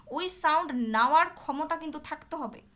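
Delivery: ordinary delivery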